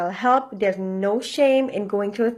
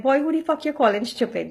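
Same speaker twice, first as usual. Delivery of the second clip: a higher, half-joking angry snap back; mock angry comeback; 5 semitones higher, faster than usual. Talking viewers out of snapping back, a mock angry retort. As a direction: play the offended guy firing back at an insult. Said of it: "instead of being mad and pissed off and be like".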